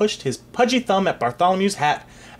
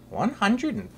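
high, softer nervous count; nervous gulp; 11 semitones higher, 4 dB quieter than usual. Acted. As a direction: gulp it out anxiously. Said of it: "gulped Sir Ulrich".

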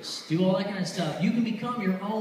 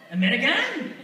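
raised, rising pitch on a questioning "American"; questioning stranger; 5 semitones higher, 4 dB louder than usual. Retold or imitated. Stranger on the street asking a tourist, questioning. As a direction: imitate a foreign stranger sizing up a tourist.

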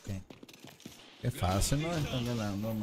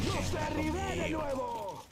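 triumphant shout; 14 semitones higher than usual. Acted. Game character callout, shouted.

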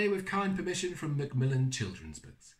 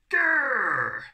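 tiger growl; 5 semitones higher, 8 dB louder than usual. Acted.

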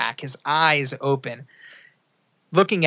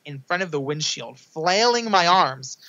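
ordinary talk, nothing marked

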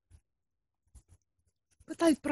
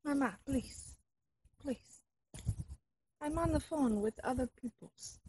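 put-on softer, hurried Russian accent plea; hushed pleading; 3 semitones higher, 8 dB quieter than usual. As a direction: hush her, others can hear. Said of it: "fake Russian spy".

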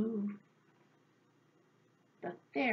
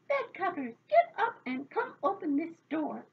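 high, forceful order; calling out; 7 semitones higher, 4 dB louder than usual. A narrator's reading. Locked-out fairies rousing the bed covers, high insistent call. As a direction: order them up impatiently.

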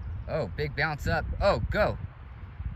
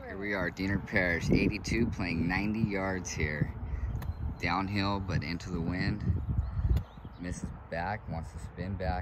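hushed TV golf commentary; golf commentator; 4 semitones lower, 6 dB quieter than usual. Acted. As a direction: call the shot like a broadcaster.

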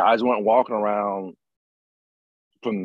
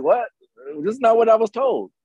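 much higher, incredulous protest; shocked disbelief; 10 semitones higher, 3 dB louder than usual. Retold or imitated. New guy finding the team unlike the movies, shocked disbelief. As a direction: act out a rookie's baffled disbelief.

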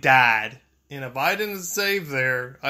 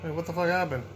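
ordinary delivery

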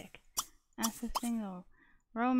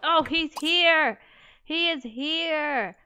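drawn-out thrilled exclamation, louder; excited; 4 semitones higher, 5 dB louder, much slower than usual. Real, not acted. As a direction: stretch it out, delighted he showed up. Sim's date finally arrives.

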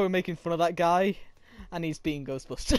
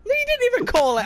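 indignant protest; 4 dB louder than usual. Real, not acted. Arguing with teammate.

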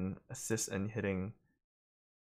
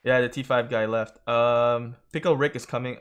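excited; 7 dB louder than usual. Real, not acted. Excited callouts during battle.

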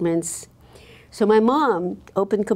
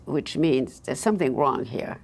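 normal talking voice

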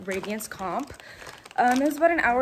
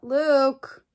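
sudden louder call to someone nearby; 3 semitones higher, 4 dB louder than usual. Real, not acted. Calls out to Luke mid-vlog.

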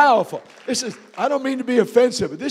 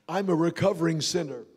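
quiet confession; 6 semitones lower, 7 dB quieter than usual. Real, not acted. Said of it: "grounds for my apology".

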